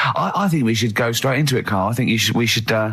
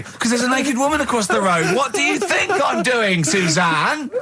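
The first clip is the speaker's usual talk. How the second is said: lightly voiced Carl, laughing at the end; hammed-up reply to the wife; mock Carl; 7 semitones higher than usual. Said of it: "acting out Carl and Suzanne".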